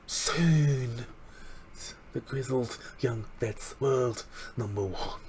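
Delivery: put-on slow Liverpool accent on "Soon" and "world number one". Fan slipping into a Liverpool accent for the catchphrase. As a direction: lean into the Liverpool accent, slowly. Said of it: "the Liverpool accent is just so strong".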